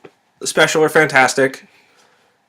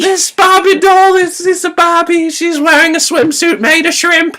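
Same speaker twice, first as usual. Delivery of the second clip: silly high voice; 5 dB louder, slower than usual. Pretend. Continues silly high character voice.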